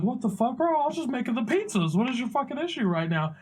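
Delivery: high, baffled "what the fuck, bro"; incredulous coworker. Retold or imitated. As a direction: play him stunned and indignant. Coworker quoted, confronted by customer.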